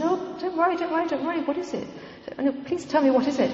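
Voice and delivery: high, gentle pleading to calm her; soothing reassurance. Retold or imitated. John comforting the sobbing woman at the conference, high and soothing.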